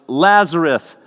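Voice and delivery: calling out high and loud across the tomb; Jesus calling Lazarus from the grave, loud high call. A narrator's reading. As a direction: call him out by name, full voice. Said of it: "Jesus called in a loud voice".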